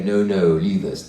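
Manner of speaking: low, slow husband puppet; put-on deep male character; man's dummy voice. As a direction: dismiss him in a deep voice. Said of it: "What about a voice like this?".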